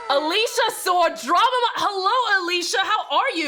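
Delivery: light up at a returning friend; excited greeting. Real, not acted.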